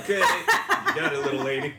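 high, laughing barkeep voice; gruff bartender voice. Acted. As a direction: play the bartender serving a drink. Bartender voice answering order.

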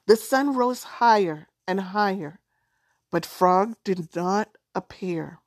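ordinary delivery

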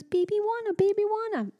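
put-on high little-girl voice; toddler voice. Mom imitating her daughter asking for the movie. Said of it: "And she goes".

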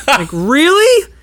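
high, drawn-out disbelief; incredulous. Acted. Hearing Carl is director's stepkid, high incredulous question. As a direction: stretch it upward in mock disbelief. Reacts to director's nepotism excuse.

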